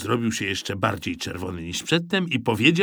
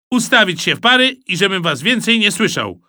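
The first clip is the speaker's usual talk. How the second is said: loud, raised commanding voice; stern order; 9 semitones higher, 6 dB louder than usual. A narrator's reading. Red-eyed supervisor ending recess, ordering loudly. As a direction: order them into line loudly.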